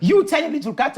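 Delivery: high, incredulous put-down; scornful mockery. Villagers belittle Cat for daring to try, scornful.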